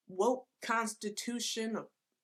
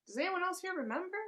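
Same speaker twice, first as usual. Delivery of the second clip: sarcastic aside; 9 semitones higher, faster than usual. Pretend. Mocking aside to audience.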